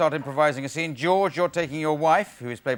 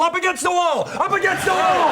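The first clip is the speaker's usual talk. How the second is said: yelled high and loud like a raiding cop; drugs squad cop; 14 semitones higher, 4 dB louder than usual. Acted. Ex-drugs-squad waiter seating diners, loud cop shout. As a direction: bark it like a cop making an arrest. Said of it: "the waiter used to be a drugs squad officer".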